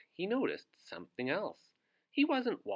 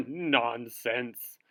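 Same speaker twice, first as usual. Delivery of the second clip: snorting wizard; 7 dB louder than usual. Acted. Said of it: "snorted the wizard".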